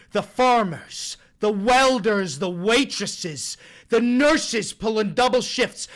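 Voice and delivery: starts hushed, builds to loud rallying oratory; stump speech. Acted. Streamer reading out a congressman's quote, mounting mock oratory. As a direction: build from a murmur to forceful speechmaking.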